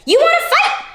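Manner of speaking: squeaky high challenge; grouchy ladybug. A narrator's reading. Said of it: "said the grouchy ladybug".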